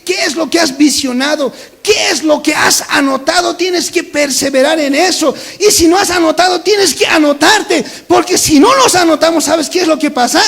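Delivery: fiery preaching. Acted. Pastor pressing the congregation about their prayers, preaching loudly. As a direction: hammer each question home.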